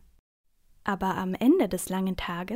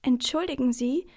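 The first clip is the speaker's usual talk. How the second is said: higher, louder polite opener; plucking up courage; 5 semitones higher, 5 dB louder than usual. A narrator's reading. Girl addressing a stranger on a train, plucking up courage. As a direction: speak up politely but bravely. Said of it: "nimmt Charlotte ihren ganzen Mut zusammen".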